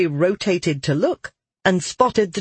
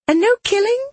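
put-on much higher, childlike character voice; childlike questioner; 3 dB louder, faster than usual. Marrow checks with the others about killing, high childlike question. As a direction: ask in a high-pitched, playful little voice.

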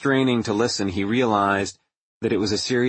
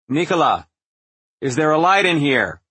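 higher, louder call into the dark; 5 semitones higher, 4 dB louder than usual. A narrator's reading. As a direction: call out, searching the room. Calling into dark lab.

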